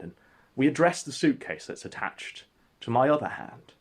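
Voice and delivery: ordinary delivery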